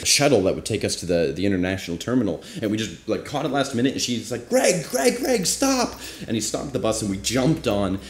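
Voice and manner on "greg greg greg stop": very high-pitched mock yell, not full volume; imitated urgent call. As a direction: call after a departing bus in falsetto. Imitating woman yelling at bus driver.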